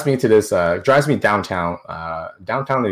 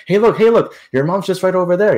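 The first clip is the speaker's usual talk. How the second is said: excited bus driver; 8 semitones higher, 5 dB louder than usual. Retold or imitated. Bus driver points out mom.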